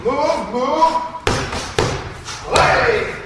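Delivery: high, loud yells to keep moving; shouted urging. Real, not acted. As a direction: push the fighter with sharp shouts. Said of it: "coach urging fighter on".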